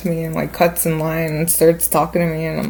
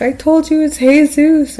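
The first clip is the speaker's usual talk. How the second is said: high, loud imitated yell; yelling over music; 10 semitones higher, 6 dB louder than usual. Guy in the background at the club, high yelling. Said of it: "yelling over the music".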